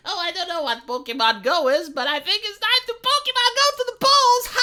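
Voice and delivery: over-chirpy imitation building into a fake laugh; Hillary Clinton. Imitating Hillary's cringe-making joke, exaggerated high cheer. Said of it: "when Hillary was like".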